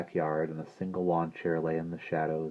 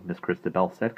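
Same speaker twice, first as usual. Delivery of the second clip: ordinary delivery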